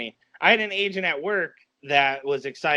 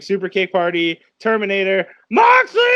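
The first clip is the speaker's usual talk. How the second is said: excited scream; 2 semitones higher, 7 dB louder than usual. Retold or imitated. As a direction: yell the names like a hyped fan. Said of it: "that's all I screamed".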